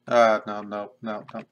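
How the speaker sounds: sudden loud protest. Real, not acted. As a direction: blurt it out at a mistake. Reacts to a mistake.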